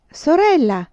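high, loud cry from the women; shouted exclamation. A narrator's reading. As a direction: call out loudly to a friend. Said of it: "urlarono le donne". (esclamazione urlata, forte e acuta)

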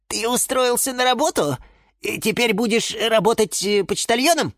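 high-pitched, wondering questions; inquisitive lemon. Acted. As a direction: ask with keen curiosity. Curious plant questions him.

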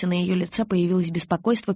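usual speaking voice

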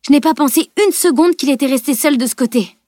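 high, agitated self-reproach; raging at herself. A narrator's reading. Camille left her friend alone, angry with herself. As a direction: pour out your annoyance with yourself. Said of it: "ragea-t-elle".

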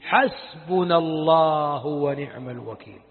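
low, slow and solemn, every word weighed; Abraham's grand, serene declaration; grand, beautiful voice. A narrator's reading. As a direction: declare it with calm majesty, deep and unhurried. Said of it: "ثم قال بصوت عظيم جميل".

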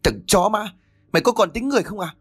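high, furious scream of outrage. A narrator's reading. Niece screaming at her cousin after he hurt his mother, furious. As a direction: scream the insult at him. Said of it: "Thu liền gào lên".

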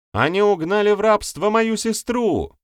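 very high, loud accusing cry; impassioned shout, pitched far up; woman's outcry. A narrator's reading. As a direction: cry out as a young woman wronged. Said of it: "выкрикнула молодая женщина".

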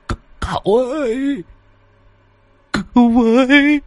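high, drawn-out desperate plea; pleading ghost. A narrator's reading. Vong lão Phọt cầu cứu cậu Tẩy, van xin hoảng hốt. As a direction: beg for rescue in a thin high wail.

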